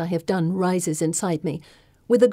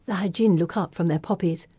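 ordinary delivery

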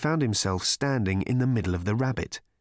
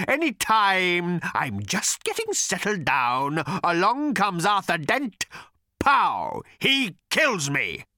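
furious bellow; 10 semitones higher than usual. A narrator's reading. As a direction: rant in bitter fury. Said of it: "he bellowed".

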